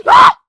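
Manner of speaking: sharp, very high shriek; jump-scare yelp; startled scream. Real, not acted. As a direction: player shrieking as a zombie appears. Startled by zombie.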